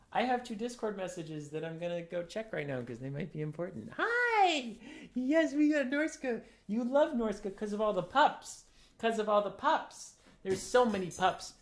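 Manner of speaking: high chirpy voice for the dog; cheerful dog talk. Real, not acted. Owner gushing to his dog about wolf pups, high chirpy talk. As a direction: gush playfully.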